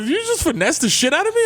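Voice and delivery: high-pitched, incredulous call-out; indignant disbelief. Acted. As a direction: confront a friend, voice climbing in mock outrage. Acted confrontation with a friend.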